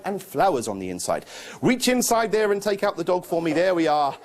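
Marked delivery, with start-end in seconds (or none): none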